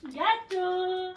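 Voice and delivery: high dog call with a drawn-out end. Real, not acted. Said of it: "j'essaye de l'appeler".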